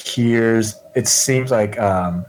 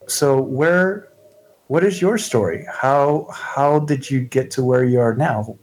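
higher, halting, inquisitive character voice; gently curious newcomer, tentative; 4 semitones higher than usual. Acted. Shauna asking the townswoman her story, tentatively curious. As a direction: feel your way into the questions.

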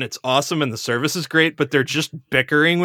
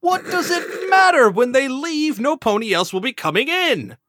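higher, hammed-up frustrated father; mock bitter outburst; 9 semitones higher than usual. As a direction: throw up your hands at the empty restaurant. Father bickers with daughter.

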